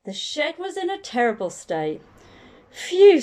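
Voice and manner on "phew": high puffed-out phew; mock dismayed phew. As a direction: blow out at the mess. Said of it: "said Mum".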